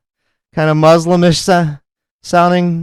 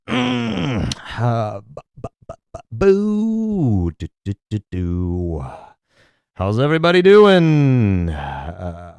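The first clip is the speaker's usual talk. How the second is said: mock rambling; 3 semitones lower, 3 dB quieter, slower than usual. Imitating rambling with nothing prepared.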